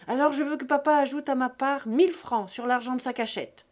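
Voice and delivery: heated, loud ultimatum; angry demand. A narrator's reading. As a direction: push the demand at full voice. Buteau angrily demands money.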